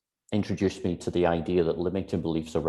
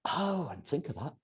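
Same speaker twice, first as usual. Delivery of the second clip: softer, higher sheepish realisation; 6 semitones higher, 8 dB quieter than usual. Retold or imitated. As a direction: admit it a little embarrassed. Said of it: "I'm like".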